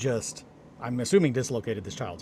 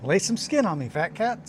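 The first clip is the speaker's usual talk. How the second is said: high, loud cheerful call; excited call; 7 semitones higher, 6 dB louder than usual. Retold or imitated. Uncle calling loudly to his nephew, high and excited. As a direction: belt it out to the kid, bouncing with glee.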